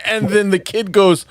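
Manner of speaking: ordinary talk, nothing marked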